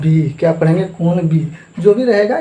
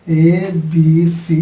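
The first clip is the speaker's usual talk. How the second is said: slow spelled out; much slower than usual. Shown as a demonstration. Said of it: "कोई त्रिभुज दे देता है आपको".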